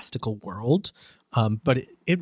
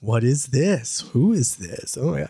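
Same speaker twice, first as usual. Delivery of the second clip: amazed disbelief. Retold or imitated. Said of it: "and she's like".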